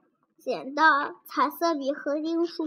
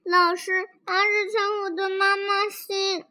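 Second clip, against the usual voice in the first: higher-pitched, mildly acted whine to teacher; tearful tattling; 4 semitones higher than usual. A narrator's reading. Schoolchild telling the teacher on 阿志, mock-tearful. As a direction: complain as if close to tears. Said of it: "小朋友哭着说".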